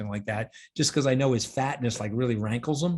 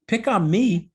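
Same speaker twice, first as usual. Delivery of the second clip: raised voice; 7 semitones higher, 7 dB louder, slower than usual. Real, not acted. Defending family against Trump's attacks.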